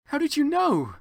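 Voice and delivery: high-pitched surprised question. Acted. Heroine surprised at Voldemort, high and startled. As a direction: gasp it out in shock. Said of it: "I asked in a surprised way".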